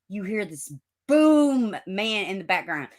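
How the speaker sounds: normal talking voice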